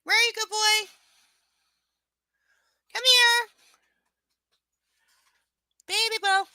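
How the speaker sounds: loud, high call across the house; calling the dog. Real, not acted. Owner searching the house, calling her dog loudly.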